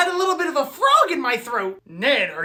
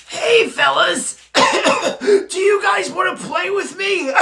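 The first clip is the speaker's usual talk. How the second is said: slow, higher, strained sickly voice; 4 semitones higher, slower than usual. Acted. Sick boy asks classmates to play, straining through coughs. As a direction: struggle the words out between coughs.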